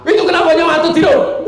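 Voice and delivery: loud, fast, high-pitched mock shout; mock angry scolding. Preacher demonstrating the anger he never shows, mock shout. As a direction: pretend to yell at sleepers. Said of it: "Belum pernah saya selama ceramah marah".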